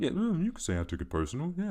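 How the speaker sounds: hint of an imitated basketball legend; Michael Jordan impression. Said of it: "But Jordan".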